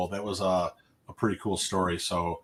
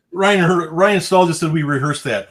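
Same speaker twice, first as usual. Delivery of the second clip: loud, higher, playfully protesting; 6 semitones higher, 7 dB louder than usual. Real, not acted. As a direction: call out the viewer's name. Denying a viewer's rehearsed claim.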